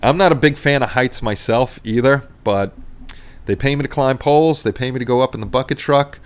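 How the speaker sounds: normal talking voice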